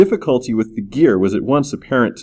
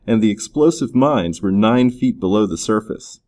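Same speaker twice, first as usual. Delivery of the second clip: usual speaking voice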